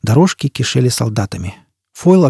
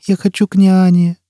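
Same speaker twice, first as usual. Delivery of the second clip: put-on high child's whine; small pleading voice, pitched up; whining child; 7 semitones higher than usual. A narrator's reading. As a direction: plead as a homesick little child. Child wants nanny.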